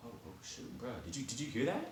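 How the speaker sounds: very soft startled question; hushed alarm. Retold or imitated. Friends hearing a snapping sound, hushed acted alarm. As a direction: drop to a hush, as if alarmed.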